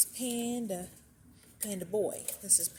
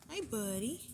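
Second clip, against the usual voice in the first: pet voice; 3 semitones higher than usual. Real, not acted. Greeting a rabbit in baby voice.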